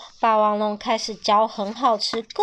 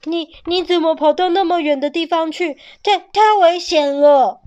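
loud, high, stammering scolding; father dinosaur yells at runaway baby; angry roar; 6 semitones higher, 7 dB louder than usual. A narrator's reading. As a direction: roar at the child out of fear. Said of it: "霸王龙生气地吼着".

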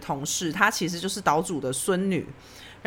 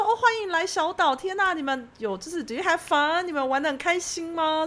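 eager hostess chatter; 8 semitones higher than usual. Retold or imitated. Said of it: "我就是一如往常的热情".

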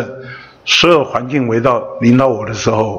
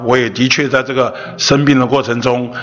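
normal talking voice

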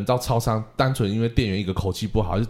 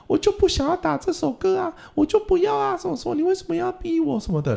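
high, whining child's voice, mock sobbing; whiny crying kid. Teacher imitating her pupil whining through tears. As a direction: ham up a kid throwing a crying fit.

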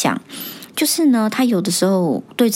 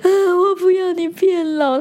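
put-on much higher, slow, wailing little-boy plea; crying child again; 12 semitones higher, slower than usual. Son breaking down again, imitated high slow wail. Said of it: "又悲从中来".

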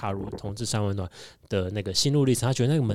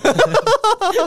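shrieking high laugh, much louder; high cackle; 9 dB louder than usual. Real, not acted. Laughing at own joke about dressing as a girl, high cackle.

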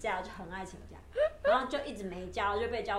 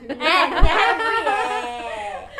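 long, drawn-out cheer, much louder and higher; playful shout; 4 semitones higher, 14 dB louder, much slower than usual. Pretend. Friends recalling their first impression, a playful laughing shout. Jokingly chanting a friend's nickname out.

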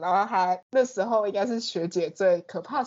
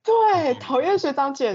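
excited; 7 semitones higher, 5 dB louder, slower than usual. Real, not acted. Eager agreement, high loud.

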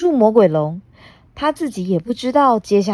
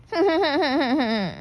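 evil mocking laugh; 8 semitones higher, 3 dB quieter than usual. Villain laughs.

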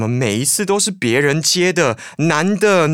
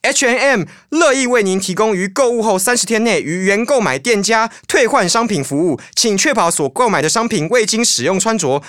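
higher, polite recorded-message voice; customer service voice; 8 semitones higher, 3 dB louder than usual. Acted. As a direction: read it like a store hotline recording.

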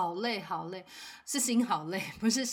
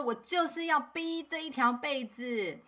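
imitated kid's slow, higher stubborn demand; angry child; 6 semitones higher than usual. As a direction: drag out the words like a sulking boy. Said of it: "他就非常的生气".

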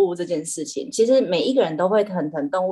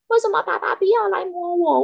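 whiny cat; 11 semitones higher than usual. Retold or imitated. Cat begs for its owner's petting in a high whiny voice. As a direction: whine it up high like a needy pet. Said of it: "它就说".

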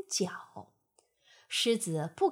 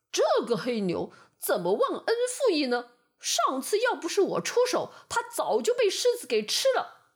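higher, louder, fast indignant thought; indignant yellow ox; 4 semitones higher, 4 dB louder, faster than usual. A narrator's reading. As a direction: grumble in offended disbelief. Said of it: "黄牛心想".

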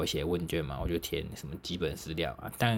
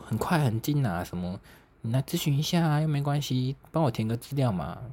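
a higher, coaxing mock salesgirl voice; lightly voiced pushy salesgirl; pushy saleswoman; 7 semitones higher than usual. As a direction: wheedle like a street promoter.

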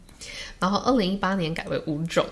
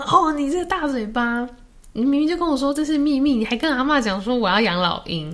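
mock scolding; 6 semitones higher, 4 dB louder than usual. Brother scolding his son.

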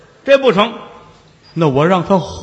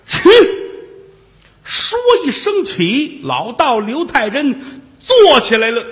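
usual speaking voice

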